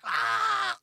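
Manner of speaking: mock rage shout; high drawn-out angry yell; angry scream. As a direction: yell as if blowing up. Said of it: "You get all angry".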